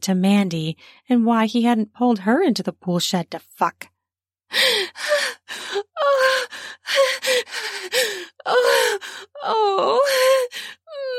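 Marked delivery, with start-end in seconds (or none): 4.5-8.4 high, drawn-out gasp; sharp gasp. A narrator's reading. Aroused heroine gasps. 8.4-11.2 high, breathy moans; moaning. A narrator's reading. Aroused heroine moans.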